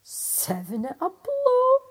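Seven at a blow, crowed high and loud; triumphant cry. Acted. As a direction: shout it out in triumph. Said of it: "cried the tailor".

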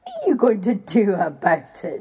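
loud mocking giant; the giant daring Jack; giant's taunt. A narrator's reading. Giant taunting Jack, loud and scornful. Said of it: "said the giant".

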